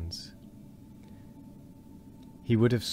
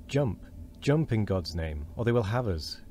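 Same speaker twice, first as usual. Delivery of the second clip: usual speaking voice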